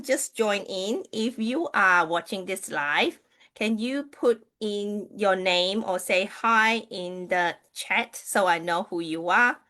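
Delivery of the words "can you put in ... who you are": normal talking voice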